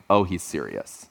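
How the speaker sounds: low, soft aside; retold hushed realization. Daughters realize dad is serious, hushed. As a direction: drop low and quiet, as if between sisters.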